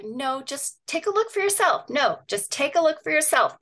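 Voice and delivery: urging a doubter, voice lifted; insistent retort. Retold or imitated. Acting out her repeated answer.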